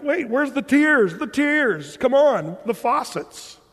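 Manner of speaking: high, loud mock indignation; where are the tears, demand it; mock outrage. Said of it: "And I think".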